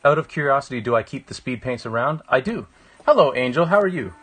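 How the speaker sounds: ordinary talk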